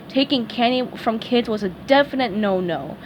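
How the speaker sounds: ordinary delivery, nothing marked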